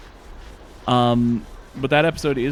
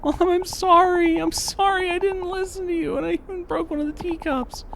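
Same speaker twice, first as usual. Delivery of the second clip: put-on extremely high, squeaky apologetic kid; exaggerated child voice. Child apologizing to mom over a broken teacup, squeaky falsetto. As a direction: plead like a tiny guilty child in falsetto. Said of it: "That was the worst voice I've ever done".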